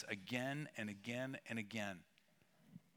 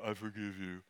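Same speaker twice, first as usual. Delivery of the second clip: put-on grudging kid; 6 semitones lower, 4 dB quieter than usual. Kid forced to forgive sister.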